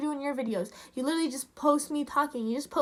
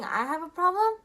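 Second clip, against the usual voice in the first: higher, rising, offended disbelief; incredulous echo; 4 semitones higher than usual. Retold or imitated. Creator quoting a man's accusation back at him, incredulous. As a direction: throw it back as a disbelieving question. Indignantly echoing his accusation.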